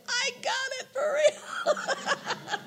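high, slow, laughing cry of joy; joyful triumph. Retold or imitated. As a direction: stretch it out in delight. Triumphant moment retold.

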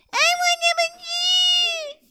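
high, slow drawn-out whine; whiny baby. Acted. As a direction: stretch the whine out like a toddler. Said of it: "Everything the baby said was a whine".

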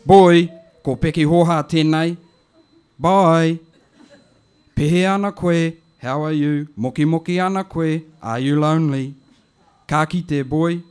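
an auntie leaving a friendly message; a hint of the auntie voice; lightly voiced auntie. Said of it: "it goes like this".

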